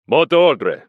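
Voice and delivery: guard insisting on the password. A narrator's reading. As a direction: repeat it harder. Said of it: "repeated the Russian".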